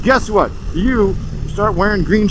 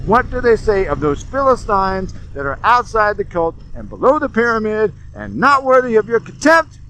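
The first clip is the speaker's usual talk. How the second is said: ordinary delivery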